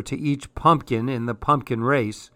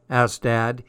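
plain reading voice

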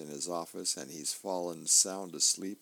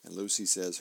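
ordinary talk, nothing marked

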